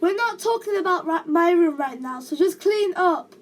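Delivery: high, loud, cross mum voice; exasperated mother laying down the law; scolding mother. A narrator's reading. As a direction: shut the argument down and order him. Mother scolds son in argument.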